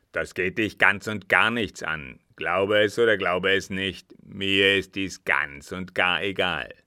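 loud, irritated dismissal; brusque rebuff. Acted. Captain refusing his companion's prying question, brusque rebuff. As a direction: shut the question down forcefully.

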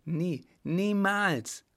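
high, drawn-out cry; crying out in refusal. A narrator's reading. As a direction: call out, stretching each word. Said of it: "rief sie".

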